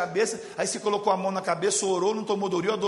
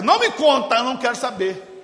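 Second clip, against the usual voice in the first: panicked refusal; 4 semitones higher, 7 dB louder than usual. Retold or imitated. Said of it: "Ela pegou assim".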